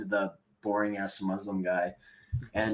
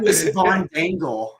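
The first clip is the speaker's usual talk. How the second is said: hammed-up character; 8 semitones higher, 5 dB louder than usual. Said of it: "playing a character and, like, hamming it up".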